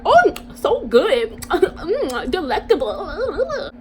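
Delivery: high, slow, drawn-out mock relish; slow exaggerated foodie voice; mock savoring. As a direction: ham up tasting something delicious. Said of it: "they're up here".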